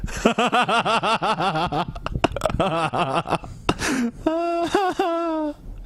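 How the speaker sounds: high, wheezy cackling fit; long helpless laughter. Real, not acted. Listener cracks up at the whooping story, helpless laughter.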